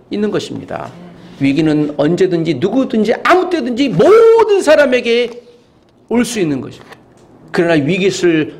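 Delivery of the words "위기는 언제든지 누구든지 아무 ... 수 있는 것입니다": emphatic preaching. Real, not acted. Preacher warning that crisis can hit anyone, mounting intensity. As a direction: build the warning up to a stretched high point.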